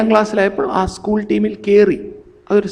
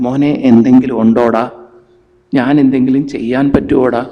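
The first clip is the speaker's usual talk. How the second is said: gentle parent; 5 semitones lower, 5 dB louder than usual. Pretend. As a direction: coax it out of him kindly. Parent gently asks son.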